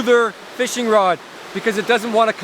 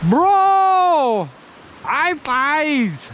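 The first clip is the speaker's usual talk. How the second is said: high-pitched thrilled yelling; excited shouting; 12 semitones higher, much slower than usual. Real, not acted. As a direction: whoop like you just landed it. Landing a trout, celebrating.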